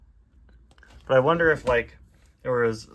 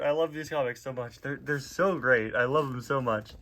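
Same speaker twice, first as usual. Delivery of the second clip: ordinary delivery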